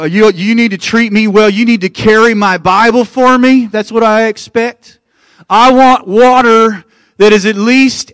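high, loud mock-entitled pastor voice; demanding pastor. Pastor listing how he needs treating, mock demanding. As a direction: reel off pompous demands as a put-on diva pastor. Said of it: "imagine if I came in here today and said".

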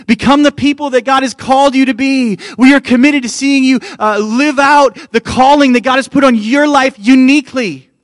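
high, loud, impassioned preacher; full-force preaching. Real, not acted. Pastor pledging to raise up his church, full-force preaching. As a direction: proclaim it at the top of your voice.

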